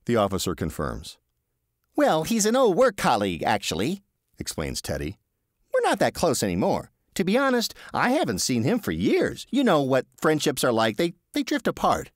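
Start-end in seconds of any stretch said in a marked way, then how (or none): 2.0-12.1 higher, apologetic Teddy; sheepish Teddy. Acted. Teddy apologising to the receptionist, higher sheepish voice. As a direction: backpedal politely, a little flustered.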